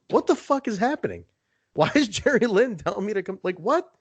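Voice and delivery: high, stunned what-the-hell; baffled disbelief. Retold or imitated. Confused listener reacts to surprise voicemail, high and stunned. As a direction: sound utterly thrown by the call. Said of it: "I'm like".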